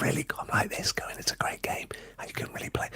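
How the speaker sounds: whispered imitation of a muted mic; barely audible. Showing how his stream sounded at zero gain, barely audible. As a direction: mouth it in a near-silent whisper. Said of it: "You can't hear me in the whole freaking thing".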